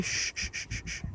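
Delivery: soft breathy shush; calm the animals down. Real, not acted. Owner hushing the dogs among the sheep, soft.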